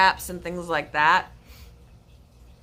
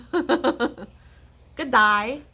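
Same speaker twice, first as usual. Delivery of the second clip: higher, phonetically faked Aussie greeting; mock Australian accent; 4 semitones higher than usual. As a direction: put on an Aussie "Good day". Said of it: "pronounce it phonetically".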